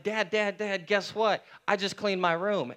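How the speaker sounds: put-on high, loud eager child calling Dad; bursting to tell Dad the news; excited kid. Kid calling to his dad, high and eager.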